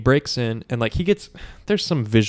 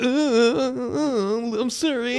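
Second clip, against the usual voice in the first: high-pitched, quavering stammer; mock cowardly whine; whimpering coward; 12 semitones higher, slower than usual. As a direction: beg in a hammed-up frightened squeal. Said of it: "[name] again does the coward".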